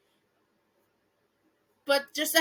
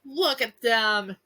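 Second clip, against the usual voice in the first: ordinary delivery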